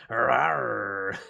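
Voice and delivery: loud, drawn-out rolled growl; mock big-cat roar; playful lion roar. Streamer cheering the lion man card, playful roar.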